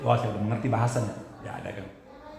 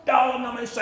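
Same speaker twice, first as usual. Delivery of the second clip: higher-pitched mock yell, no real volume; retold prayer shout; 10 semitones higher than usual. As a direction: re-enact crying out in prayer. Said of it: "saya mulai berteriak".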